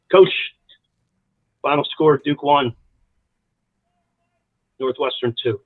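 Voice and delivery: halting, with long pauses; choked up. Real, not acted. Coach relaying the phone call after his mother's death, choked up. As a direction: hold back emotion between each phrase. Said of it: "I get choked up telling you story".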